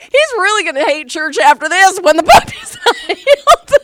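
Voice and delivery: laughing worried thought. Retold or imitated. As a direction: giggle through the worry.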